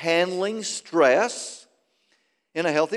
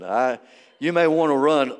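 ordinary delivery

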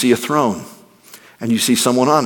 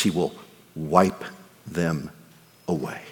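tender hush; 9 semitones lower, 9 dB quieter than usual. Real, not acted. Preacher tenderly assures grieving listeners, hushed and soft. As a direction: let it sink to a near whisper of comfort. Tender comfort about wiping tears.

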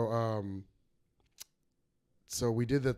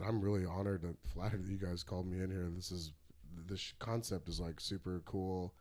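mock-polite, quiet and measured; polite, soft refusal; 4 semitones lower, 8 dB quieter than usual. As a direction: play it humbly polite and soft. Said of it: "real politely".

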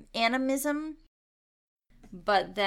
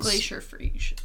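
whispered. Retold or imitated. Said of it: "repeating the name".

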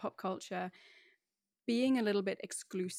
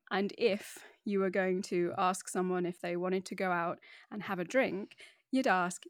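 ordinary talk, nothing marked

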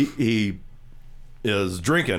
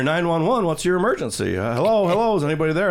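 911 operator; 7 semitones higher than usual. Retold or imitated. 911 operator heard from phone.

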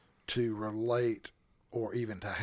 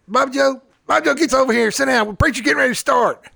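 calling out; 13 semitones higher, 13 dB louder, faster than usual. Retold or imitated. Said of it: "She goes".

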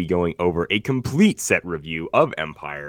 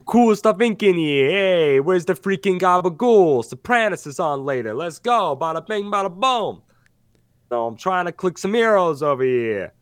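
higher, louder New York mobster swagger; Brooklyn wiseguy voice; 8 semitones higher, 4 dB louder than usual. Acted. Host hamming up the Brooklyn voice for his co-host. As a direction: bust in like a wiseguy at a deli. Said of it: "the voice I'm doing".

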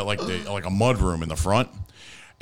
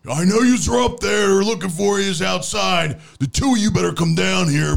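high, loud shouted biker impression; 10 semitones higher, 4 dB louder than usual. Uncle calling up to the two hiding upstairs, biker yell. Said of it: "he sounded like a biker".

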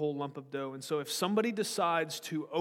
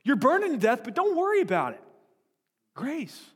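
mock sweet reassurance; 13 semitones higher, 5 dB louder than usual. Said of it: "Instead of going".

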